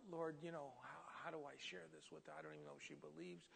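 very soft, private murmur; inner prayer. Retold or imitated. Passenger silently asking the Lord for guidance, hushed murmur. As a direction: think aloud under your breath. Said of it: "I'm going through all these thoughts".

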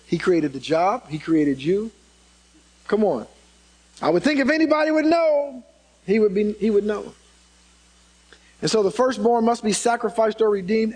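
ordinary talk, nothing marked

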